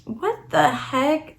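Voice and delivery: high, slow, drawn-out disbelief; baffled what the heck. Retold or imitated. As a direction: stretch it out in stunned confusion. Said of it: "So I'm over here like".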